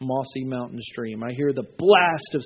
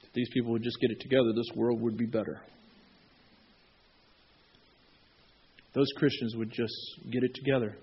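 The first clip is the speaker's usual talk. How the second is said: grumbling God; 5 semitones lower, 7 dB quieter than usual. Retold or imitated. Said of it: "God is not sitting there going".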